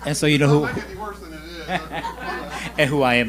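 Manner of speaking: giggling through the words; laughing. Real, not acted. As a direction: let the laughter spill in. Laughing at her own joke.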